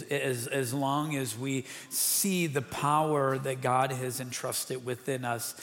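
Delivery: rising, fervent sermon delivery; building preaching. Real, not acted. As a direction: press the point harder each line. Pastor preaches to congregation.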